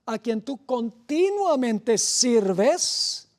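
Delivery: "continuamente" stretched very slowly and pushed out; slow right down on "continuamente" and rise on it; preacher's weight on "continuamente"; stress on continuamente. Shown as a demonstration. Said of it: "y se repite otra vez".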